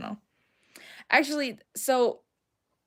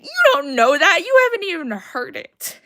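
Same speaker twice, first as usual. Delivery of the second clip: high, loud outburst; shouted protest; 8 semitones higher, 12 dB louder than usual. Real, not acted. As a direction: cut in with a yelled objection. Protests at chat's reassurance.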